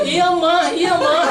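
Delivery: high, loud eager reply of a husband; husband answering his wife. Retold or imitated. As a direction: chirp back obediently to the wife's call. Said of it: "terus dia bilang lagi". (suami menjawab panggilan istri, nada tinggi dan keras)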